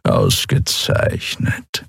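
low, slow, breathy purr. Acted. Cat master pleased with his servant, purring low. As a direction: purr the word like a content cat. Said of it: "schnurrte Chasu".

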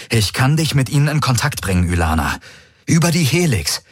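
lightly voiced desperate plea; pleading envoy. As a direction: beg her to call the ships back. Garlin offers contact.

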